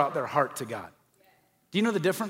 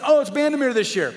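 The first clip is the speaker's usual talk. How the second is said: eager chatter; 8 semitones higher, 5 dB louder than usual. Retold or imitated. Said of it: "everybody started saying".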